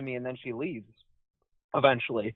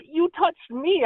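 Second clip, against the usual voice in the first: higher, slow drawn-out accusation; mock indignant retort; 10 semitones higher, slower than usual. Retelling what he said to the man who poked him, slow mock indignation. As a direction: stretch it out, pointedly wounded. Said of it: "And I was like".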